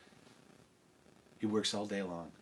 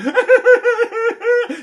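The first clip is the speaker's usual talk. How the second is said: very high, loud wail; comic bawling; 18 dB louder than usual. Acted. Princess at the castle window, high loud bawling. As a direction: wail in rapid shuddering bursts.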